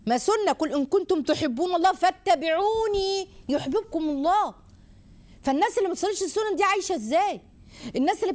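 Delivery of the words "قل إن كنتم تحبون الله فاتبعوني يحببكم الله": quranic recitation. Real, not acted.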